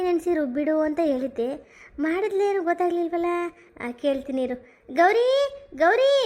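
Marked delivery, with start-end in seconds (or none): 5.0-6.2 mother-in-law calling louder across the house. Acted. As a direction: call the name out loud, rising. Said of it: "ಏನು ಕೂಗ್ತಾಳೆ ನಮ್ಮ ಅತ್ತೆ?".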